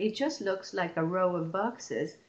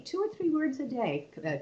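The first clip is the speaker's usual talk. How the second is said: normal talking voice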